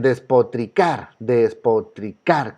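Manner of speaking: des-po-tri-CAR, slow, leaning on CAR; stress on final syllable. Shown as a demonstration. Teacher quizzes students on despotricar's strongest syllable, slow stress on CAR. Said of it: "¿Cuál es la que suena más fuerte?".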